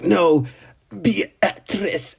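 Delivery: high, mock-dramatic plea; desperate cry. A fan acts Subaru holding a leg, mock-desperate cry. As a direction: call out her name in hammed-up desperation.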